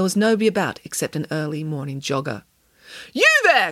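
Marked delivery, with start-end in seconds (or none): 3.1-3.7 high, loud hail; calling out. A narrator's reading. Nanny hailing a jogger from window, loud high call. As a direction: call down sharply to a passer-by. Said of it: "called Nanny Piggins".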